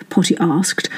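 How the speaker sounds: ordinary delivery, nothing marked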